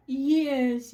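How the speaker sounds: ordinary delivery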